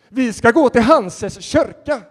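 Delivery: high, loud and slow; a slow, booming declaration; loud proclamation. Retold or imitated. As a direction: announce it proudly to the whole room. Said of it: "ropar han ut så här".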